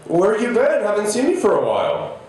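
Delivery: high-pitched, excited hello to a regular; hearty greeting. Retold or imitated. Cashier greeting a long-missed regular, excited and high-pitched. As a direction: imitate a cashier thrilled to see a regular.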